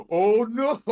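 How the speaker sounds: startled cry of Oh no; loud alarmed gasp of words; surprised. Acted. Player reacts in character.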